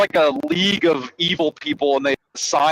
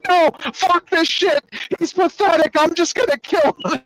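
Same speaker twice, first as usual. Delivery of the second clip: exasperated villain; 13 semitones higher than usual. Retold or imitated. Villain refusing his colleagues' pleas to calm down, exasperated. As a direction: play a villain who won't be talked down, pitched way up.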